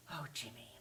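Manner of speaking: soft comforting whisper. Acted. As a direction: hush him gently. Mother comforts Jimmy.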